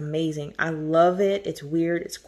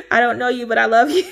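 laughing through the words, louder; 5 semitones higher, 7 dB louder than usual. Real, not acted. Thanking the secret sender, laughing loudly and gushing. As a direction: giggle it out, overwhelmed with joy.

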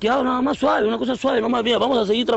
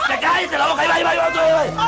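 higher, heated yelling; angry dismissal; 5 semitones higher than usual. Acted. Driver silences rival during road quarrel, heated yelling. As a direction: yell the other driver down.

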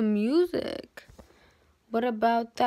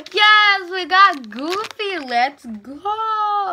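high, loud, drawn-out celebration; thrilled unboxing reaction; excited cheer; 5 semitones higher, 7 dB louder, much slower than usual. Real, not acted. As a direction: cheer as if you just won the toy. Opening toy, thrilled at Goofy.

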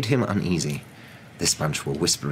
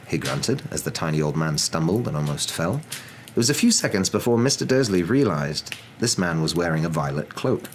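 plain reading voice

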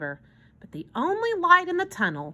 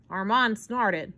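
ordinary delivery, nothing marked

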